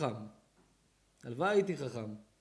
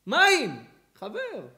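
loud, high exclamation; loud cry; 9 semitones higher, 11 dB louder, much slower than usual. Acted. Preacher cries water to the crowd, loud. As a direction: fling the word out.

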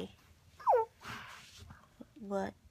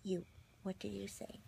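softer whispered question; 10 dB quieter than usual. Real, not acted. Parent whispering to the baby, softer.

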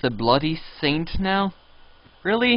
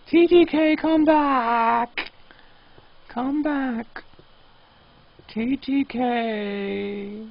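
high plea dying away to a slow moan; mock calling, fading; 9 semitones higher, much slower than usual. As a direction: call him back, then trail off sadly. Calling player back.

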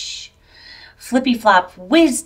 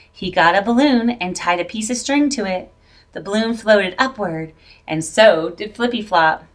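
plain reading voice

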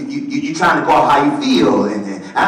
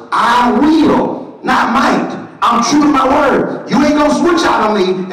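firm voice of God; 12 semitones higher, 3 dB louder, slower than usual. Acted. Voicing God firmly.

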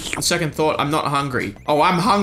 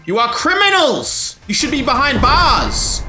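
mock scolding; 6 semitones higher, 3 dB louder than usual. Voicing NPC scolding Team Yell.

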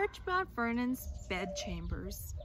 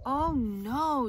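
louder, higher mock moan; 4 semitones higher, 9 dB louder than usual. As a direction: moan over losing every tooth. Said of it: "George moaned".

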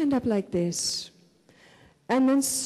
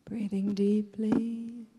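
guided meditation; 4 dB quieter than usual. Real, not acted. Leads a breathing meditation.

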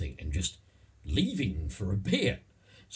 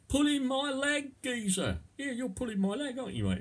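playful cockney. Acted. Acting a cockney chat.

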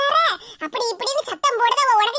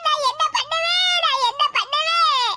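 high slow wail of despair, crying out; 5 semitones higher than usual. Acted. Mother wails over her collapsed husband, crying. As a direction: sob out the helpless lament. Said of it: "ஏம்மா, அழாதம்மா".

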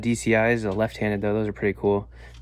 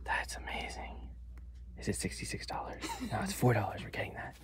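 hushed whisper in a store; 3 semitones lower, 12 dB quieter than usual. Real, not acted. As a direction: whisper so nobody hears. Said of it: "Why are you whispering?".

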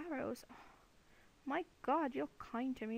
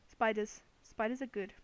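ordinary delivery

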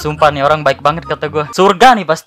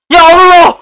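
shocked shout; 12 semitones higher, 8 dB louder, slower than usual. Real, not acted. Shocked exclamation.

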